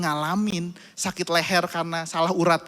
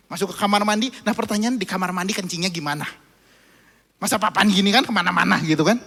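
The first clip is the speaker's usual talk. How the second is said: normal talking voice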